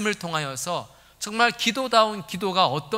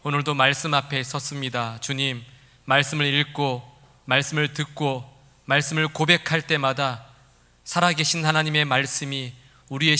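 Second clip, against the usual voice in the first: earnest, measured prayer voice; opening prayer; 2 semitones lower than usual. Real, not acted. Pastor praying before the sermon, earnest and steady. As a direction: pray aloud before the congregation.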